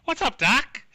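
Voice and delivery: high, loud cartoon catchphrase; cartoon character. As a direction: toss it out like a cartoon wise guy. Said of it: "Sound like a cartoon character".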